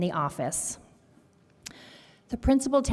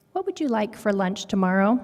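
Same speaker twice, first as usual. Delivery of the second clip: ordinary delivery, nothing marked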